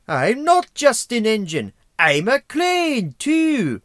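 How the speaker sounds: hammed-up Irish accent, high, loud and slow. Reviewer mimicking Harvey the crane engine, exaggerated Irish accent. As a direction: belt out a bad, drawn-out Irish accent. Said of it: "I think that's an Irish accent".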